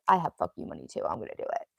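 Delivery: a hammed-up low smug voice; mock tough self. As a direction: growl it like a smug rich guy. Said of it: "So I was like".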